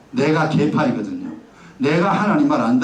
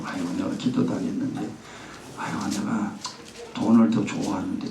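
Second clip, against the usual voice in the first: guilty murmur; 9 semitones lower, 8 dB quieter than usual. Retold or imitated. Self-reproach murmured in prayer.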